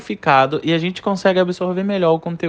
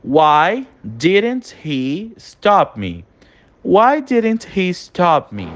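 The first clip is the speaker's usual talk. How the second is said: slow dictation; 3 semitones higher, much slower than usual. Shown as a demonstration. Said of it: "Vamos ver a próxima frase".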